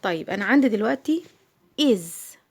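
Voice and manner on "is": إيز with short i, "iz"; isolated إيز, not the letter name I; short vowel. Shown as a demonstration. Teacher showing the first letter's sound, short vowel. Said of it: "صوت قصير".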